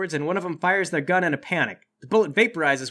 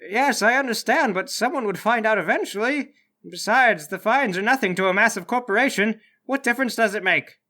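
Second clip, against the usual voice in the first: higher-pitched doctor's voice; 4 semitones higher than usual. Acted. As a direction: argue back as the doctor, pitched up. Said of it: "The doctor says".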